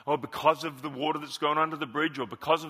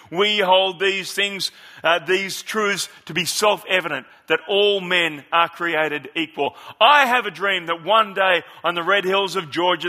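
Martin Luther King oratory; 7 dB louder than usual. Acted. A pastor reading the speech aloud to his flock, louder oratory. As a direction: recite it like the great orator. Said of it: "let me just take you this little bit".

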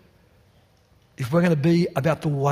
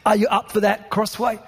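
impassioned preaching; 5 semitones higher than usual. Acted. Rising passionate preaching climax.

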